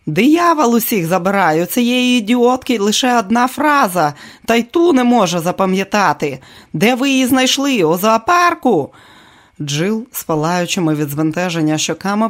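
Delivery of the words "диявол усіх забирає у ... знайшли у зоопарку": angry rant. Acted. Director berating actress.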